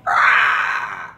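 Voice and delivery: short harsh unvoiced scream, loud; death scream. Servant shot in the head, loud death scream. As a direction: cry out as the bullet hits. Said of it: "you hear the servant go".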